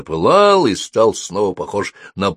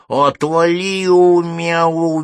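high, drawn-out meowed words; meowing cat; 10 semitones higher, 5 dB louder, much slower than usual. A narrator's reading. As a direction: turn the words into a cat's yowl. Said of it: "мяукнула она". (мяукающий кошачий голос)

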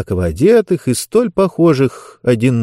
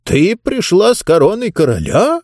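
astonished question; 9 semitones higher than usual. Acted. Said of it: "округлил глаза Бон".

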